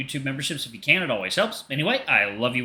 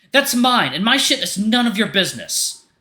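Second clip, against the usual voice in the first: panicked angry shout; 11 semitones higher, 7 dB louder than usual. Acted. Artemis shouting at Leo and Hunter over the license, high furious yell. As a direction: yell it to stop their eyes.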